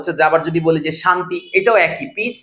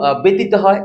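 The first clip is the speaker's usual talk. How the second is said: usual speaking voice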